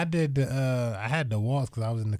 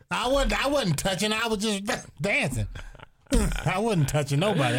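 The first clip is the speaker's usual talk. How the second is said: high protesting voice, much higher; whiny excuse, pitched way up; Kenny pleading; 9 semitones higher than usual. Retold or imitated.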